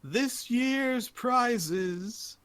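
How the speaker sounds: very slow, high announcer call; arena announcer. Acted. Announcer presenting prizes as audience calms, grand showman. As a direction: draw each word right out. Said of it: "he says".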